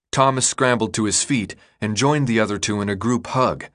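ordinary delivery

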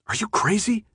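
ordinary delivery, nothing marked

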